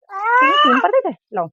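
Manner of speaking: loud, slow scolding shout at the son; angry shout. Real, not acted. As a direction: shout him down to hand it over. माँ बेटे पर चिल्लाती है. (बेटे को डाँटती हुई ऊँची, धीमी चीख)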